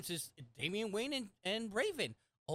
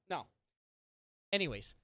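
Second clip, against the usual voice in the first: usual speaking voice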